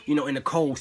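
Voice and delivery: ordinary talk, nothing marked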